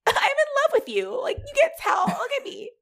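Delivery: high, laughing shouted love confession; gushing confession. Retold or imitated. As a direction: blurt it out giddy and overwhelmed. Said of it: "she's like".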